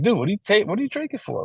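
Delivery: high, casual dude asking why he drinks; buddy at the bar. Retold or imitated. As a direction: toss the question off like a bar buddy. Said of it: "And they're like".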